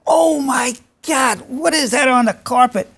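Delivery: imitating an appalled son, drawn out and high; upset son. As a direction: stretch out a high, horrified mock outcry. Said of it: "how upset he was".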